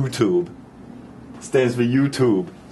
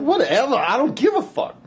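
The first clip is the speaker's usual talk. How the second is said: dismissive, pitched way up; brushing her off in a squeaky voice; high mock brush-off; 13 semitones higher than usual. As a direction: toss it off high and careless. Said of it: "I'm like".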